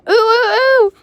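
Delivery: high, loud squealing ooh; trucks screaming. Acted. Silly trucks squealing as Edward jerks them, high loud scream. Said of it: "screamed the trucks".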